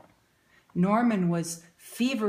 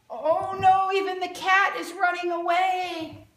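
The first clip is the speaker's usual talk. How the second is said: mock dismay; 8 semitones higher, 4 dB louder than usual. Reacting to picture for children.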